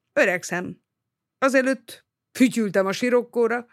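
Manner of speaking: plain reading voice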